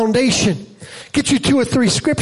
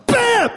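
mock bam; 14 semitones higher than usual. Giraffe mother kicks again.

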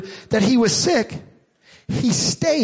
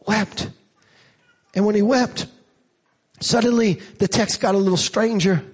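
normal talking voice

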